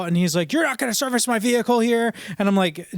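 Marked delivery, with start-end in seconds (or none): 0.5-2.1 high-pitched mock outrage of a customer; imitated freak-out. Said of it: "the guy starts freaking out".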